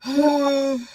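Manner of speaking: long, audible sigh; weary exhale with voice in it; heavy sigh. Real, not acted. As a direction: let out a drawn-out sigh. Overwhelmed before the fight.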